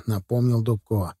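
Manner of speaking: ordinary delivery, nothing marked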